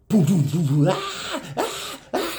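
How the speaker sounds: mock booming thuds, then high wails; imitated thuds and screams. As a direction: act out the crash and the screaming from upstairs. Imitating noise and screaming upstairs.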